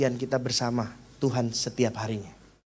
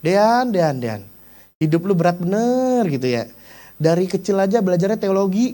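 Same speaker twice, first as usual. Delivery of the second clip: teasing younger brother; 4 semitones higher, 3 dB louder than usual. Retold or imitated. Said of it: "adik gua kadang bercanda ya".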